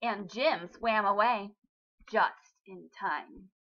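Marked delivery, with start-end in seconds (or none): none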